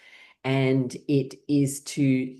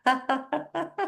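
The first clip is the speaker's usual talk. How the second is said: ha ha rising into a higher laugh; waking laugh; 8 semitones higher, slower than usual. Shown as a demonstration. Waking up in bed, laugh climbs higher. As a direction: let the laugh lift as you wake. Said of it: "you can just go".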